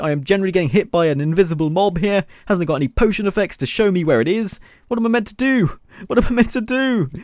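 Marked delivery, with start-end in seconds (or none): 4.9-7.1 high-pitched helpless plea; flustered. Real, not acted. Gamer stuck fighting an invisible mob, flustered. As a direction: plead for a way out, flustered.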